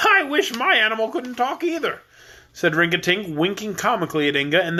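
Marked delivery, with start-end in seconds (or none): 0.1-2.0 high, jolly voice of a stout king; fat jolly king. Acted. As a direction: play the cheerful fat king, pitched up.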